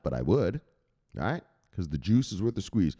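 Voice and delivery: normal talking voice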